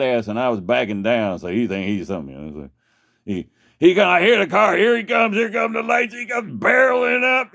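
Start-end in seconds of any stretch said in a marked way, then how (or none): 3.8-7.5 excited. Real, not acted. Cop car rushing at him.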